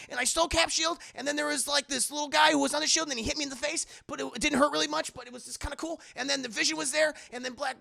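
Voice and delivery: high, loud rambling gush; breathless excited teen. Retold or imitated. Imitating Peter telling viewers about the battle, high thrilled ramble. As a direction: recount it like an overexcited kid.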